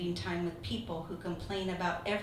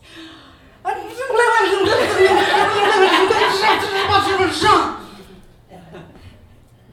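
a long, high, very loud raging wail; furious scream; 11 semitones higher, 19 dB louder than usual. Retold or imitated. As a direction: let out the full rage in one long cry. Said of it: "I went something like this".

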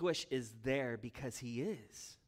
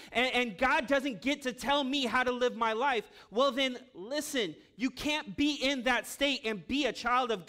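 loud, driving pulpit voice, pitched higher; full-force preaching; 8 semitones higher, 6 dB louder than usual. Acted. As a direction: preach it hard to the whole room.

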